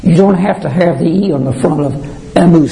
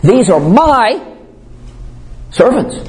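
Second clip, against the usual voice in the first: "my" raised high and stretched, rising; "my" pitched up and slowed, insisting they belong; emphatic my; 6 semitones higher, slower than usual. Shown as a demonstration. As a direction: lift "my" high and hold it, then servants. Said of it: "you want to emphasize it".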